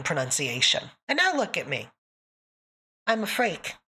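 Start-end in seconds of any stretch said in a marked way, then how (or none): none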